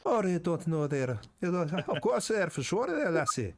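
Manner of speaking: put-on playful Irish accent teasing the players. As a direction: keep the hammed-up Irish GM going. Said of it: "That was a perfect Irish accent".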